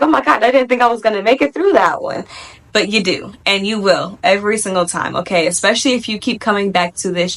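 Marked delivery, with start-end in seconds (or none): 0.0-2.2 breathless, fast and higher; out of breath. Retold or imitated. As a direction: gasp it out in relief. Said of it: "you're just sitting back like".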